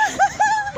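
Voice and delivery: loud high laugh. Real, not acted. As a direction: burst out laughing. Laugh after plea.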